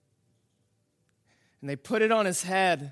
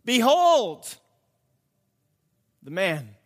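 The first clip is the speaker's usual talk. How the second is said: Pilate's 'Behold' rung out, the man dropping off; proclaimed announcement; 5 dB louder, slower than usual. Acted. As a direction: announce it to the crowd. Pilate presents Jesus, proclaimed.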